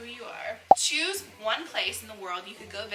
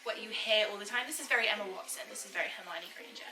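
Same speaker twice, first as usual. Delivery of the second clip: very Emma Watson, posh British accent; posh English accent. Shown as a demonstration. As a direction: do a proper Hermione-style English accent. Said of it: "this is a very posh accent".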